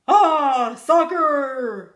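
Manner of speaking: loud crew echo; crew repeating. A narrator's reading. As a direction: shout it back together. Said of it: "a répété l'équipage".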